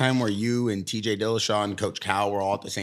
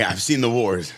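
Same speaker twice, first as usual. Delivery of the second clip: loud, higher mocking voice of a lazy coach; hammed-up mock coach; 3 semitones higher, 8 dB louder than usual. Host mocking a lazy coach, loud and high. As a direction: lampoon the coach who brags.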